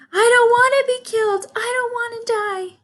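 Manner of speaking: very high, loud, fast piglet cry; a pig racing around in panic; frantic screaming. A narrator's reading. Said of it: "screamed Wilbur, racing up and down".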